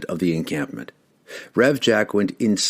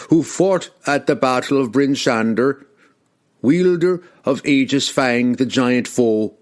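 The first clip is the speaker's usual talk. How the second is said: slow, higher and louder recital of titles; proud proclamation; 4 semitones higher, 3 dB louder than usual. Acted. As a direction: ring out each title proudly. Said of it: "he proclaimed proudly".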